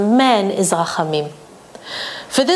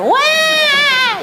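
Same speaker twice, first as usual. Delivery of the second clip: high mock wail. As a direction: fake child's crying wail, much higher. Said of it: "yell one octave higher".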